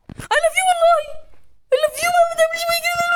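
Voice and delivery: very high, loud begging; shrieked pleading. Real, not acted. Player begging the game to spare her, shrieked plea. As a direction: plead at a high-pitched scream, speeding up.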